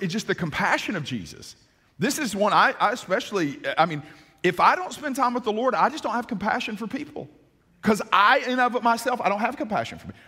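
usual speaking voice

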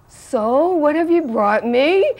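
high, loud, expectant question; Prabhupada. Retold or imitated. Guru asking departing disciple for a gift, high loud imitation. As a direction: imitate a teacher's delighted demand, rising at the end. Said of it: "and Prabhupada said".

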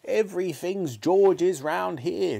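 high, slow, fed-up shout; grandma shouting. A narrator's reading. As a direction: complain at a high pitch, stretching the words. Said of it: "shouted Grandma".

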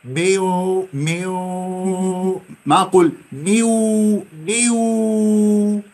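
ميو drawn very slowly with long nasal hum; held ghunna. Shown as a demonstration. Teacher drills the ghunna for students. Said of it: "ما إيش؟ غنة".

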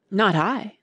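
ordinary delivery, nothing marked

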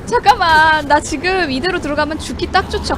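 loud frightened crying shout; panicked shout. Real, not acted. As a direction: cry out in real panic. Panicking as zombie attacks.

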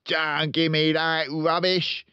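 loud, high, slow angry father; angry father shouting down; shouted. A narrator's reading. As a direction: bark it dismissively. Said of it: "the father shouted".

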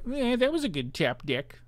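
sarcastic high sing-song echo; mock. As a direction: parrot it back in a put-on sneer. Mocking repeat of own comment.

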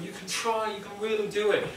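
high, bright-eyed mock cheer; mock encouraging coach. Fitness coach cheering on the class, mock encouraging. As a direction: pump them up like a cheesy trainer.

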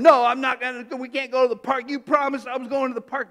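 mock child's angry outburst; tantrum. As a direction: stomp off yelling like a let-down kid.

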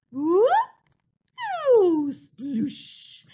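loud whoop then splashy sploosh; silly splash sound. Shown as a demonstration. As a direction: shout it like jumping into paint. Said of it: "silly sound ... for all of the times the mice jump".

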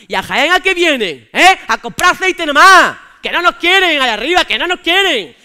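más fuerte y lento, indignación de broma; quéjate alto, arrastrando las palabras; protesta fuerte. Acted. Espontáneo protesta por Jaén. (el de Jaén se queja fuerte de Madrid)

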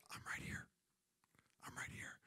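soft unvoiced whisper, close and gentle; whispered reply. God whispering back to a pleading believer. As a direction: breathe it like a secret. God voiced answering softly.

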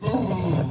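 heavy, noisy lineman exhale; exaggerated heavy puffing; breathing hard. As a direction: huff hard like a big winded lineman. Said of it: "he breathing hard like".

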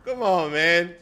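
ordinary talk, nothing marked

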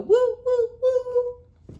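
loud, high woo woo like a train whistle. Acted. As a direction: blow the whistle with your voice.

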